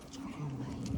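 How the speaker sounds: mild muttered dismissal; thought under breath. Retold or imitated. Owner's private thought about the rude man, muttered softly. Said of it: "that's what I thought".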